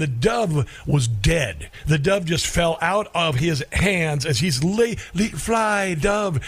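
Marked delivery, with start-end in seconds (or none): 4.6-6.4 mock dramatic release. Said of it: "like he was releasing a dove".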